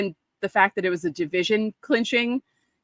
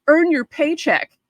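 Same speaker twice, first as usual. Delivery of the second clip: slow, higher sneering heckle; heckler's taunt; 8 semitones higher, 3 dB louder, slower than usual. Retold or imitated. Fan in the stands taunting A-Rod, slow sneering heckle. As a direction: jeer the slugger slowly. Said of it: "says, you know".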